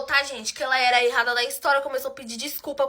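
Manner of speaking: ordinary talk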